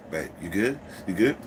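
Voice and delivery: low aside to a partner; imitated sly undertone; covert check-in. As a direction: drop the voice for a secret check. Covert check during loud stalling.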